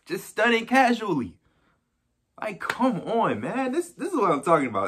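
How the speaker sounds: normal talking voice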